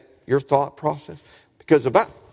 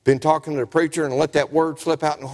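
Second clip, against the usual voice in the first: usual speaking voice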